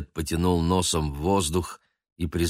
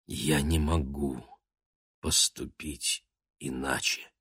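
quiet resolve; 4 semitones lower, 7 dB quieter than usual. A narrator's reading. Baguette quietly choosing honour over life. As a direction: speak softly of honour. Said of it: "тихо сказал Багет".

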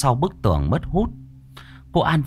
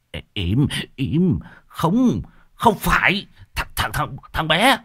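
stammering, flustered denial; hurried protesting; 5 semitones higher, slower than usual. A narrator's reading. Medium hushing the screaming woman, flustered explaining. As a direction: stumble over the words, trying to hush her. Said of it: "vội vã thanh minh".

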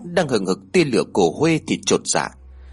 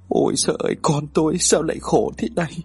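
tearful lament; 4 semitones higher than usual. A narrator's reading. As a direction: lament through welling tears. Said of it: "Hai mắt của bà rưng rưng".

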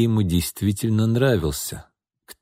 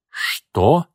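higher, startled question; taken aback; 5 semitones higher than usual. A narrator's reading. Alexei reacts to the proposition, taken aback. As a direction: bristle at the suggestion. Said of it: "вскинулся Алексей".

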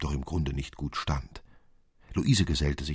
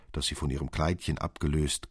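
ordinary delivery, nothing marked

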